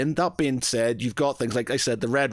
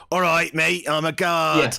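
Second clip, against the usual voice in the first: mock Cockney guard, a bit higher and louder; generic guard accent; 5 semitones higher, 4 dB louder, slower than usual. GM voicing a generic tavern guard, mock Cockney accent. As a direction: ham up a stock Cockney guard. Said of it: "the guards are always".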